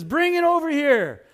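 high, carrying call; calling over. Retold or imitated. Jesus calling the fishermen in to breakfast, calling over. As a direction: call them in from the water.

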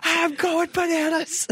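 high, slow hammed-up wail; Steve going bananas. Steve from American Dad announcing his tantrum, high and slow. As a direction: stretch it out high like a cartoon tantrum. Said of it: "he's like".

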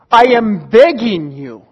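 Paul pleading on his knees; slow, loud, drawn-out plea; begging. Acted. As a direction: beg hard, letting each please fall heavily. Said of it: "you can picture Paul on his knees".

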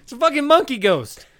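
high, loud, exasperated correction; loud insistence. Real, not acted. Arguing with a cohost over the creature, loud and high. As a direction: shout it down. Arguing bat versus monkey.

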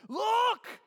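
loud, high shout; yelled. Acted. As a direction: yell it as an alert. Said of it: "the three spots that Matthew yells".

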